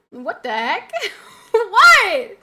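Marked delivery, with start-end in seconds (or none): 1.5-2.4 loud, high laughing shriek of disbelief; incredulous outburst. Real, not acted. Streamer reacting to JP's chat message, incredulous yelp. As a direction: yelp it in amused shock.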